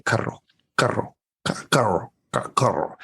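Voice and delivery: "carro" repeated haltingly, working at the trilled rr; practicing rolled R. Retold or imitated. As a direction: drill "carro" in short stop-start attempts at rolling the r. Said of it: "I would just try and I try".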